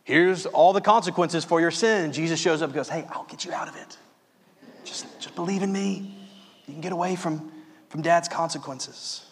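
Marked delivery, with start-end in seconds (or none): 2.9-9.3 confidential near-whisper, much softer; hushed conspiratorial aside. Acted. Preacher voices Jesus offering escape from Dad's consequences, hushed and conspiratorial. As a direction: lean in and offer a secret way out, hushed.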